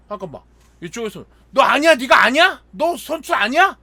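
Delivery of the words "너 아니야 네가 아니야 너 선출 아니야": loud, high accusing questions; retold fighting. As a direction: act out a heated row on the field. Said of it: "막 이래가지고 엄청 싸웠어요".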